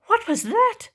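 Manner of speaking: high startled gasp; gasping alarm. Acted. Tiny startled by the ground cracking, high gasping alarm. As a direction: gasp the question in fright. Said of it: "gasped Tiny".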